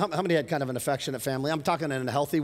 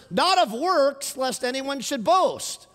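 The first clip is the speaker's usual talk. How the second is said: slow, louder, higher proclamation; emphatic scripture; 9 semitones higher, 4 dB louder, much slower than usual. Acted. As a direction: proclaim it word by word.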